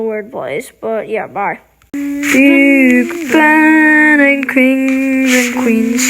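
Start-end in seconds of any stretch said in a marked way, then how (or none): 1.9-6.0 mock British. Mock British sign-off chant.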